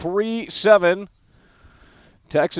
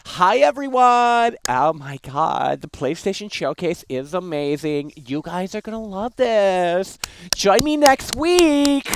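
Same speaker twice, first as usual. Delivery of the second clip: llama voice; 3 semitones higher, slower than usual. Pretend. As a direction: stretch it out like a goofy llama. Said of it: "I can talk like a llama".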